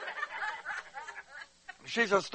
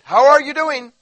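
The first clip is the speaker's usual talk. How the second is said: How are you doing said louder and higher, insistent; insistent louder greeting to ignoring stranger; emphatic greeting; 8 semitones higher, 10 dB louder than usual. Retold or imitated. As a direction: repeat the greeting with an exclamation point. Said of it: "I just put an exclamation point on it".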